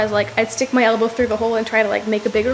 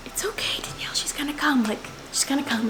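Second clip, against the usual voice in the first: imitating a friend's soft, whispery reassurance; much softer, half-whispered retelling; hushed reassurance; 3 semitones higher, 8 dB quieter than usual. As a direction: soothe a panicking friend under your breath.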